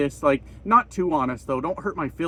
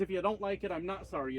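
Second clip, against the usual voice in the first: much softer, the NOT stressed; much softer correction; 13 dB quieter, faster than usual. Shown as a demonstration. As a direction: drop the voice to set it right. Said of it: "That's what I meant to say was".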